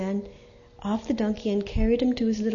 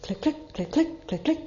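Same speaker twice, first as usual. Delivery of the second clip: higher, voiced clicks rising at each pair; nearer clicking; 6 semitones higher than usual. A narrator's reading. Narrator voicing approaching hooves, nearer clicks. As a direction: let the clicks come closer and brighter. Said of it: "The sound seemed to come nearer".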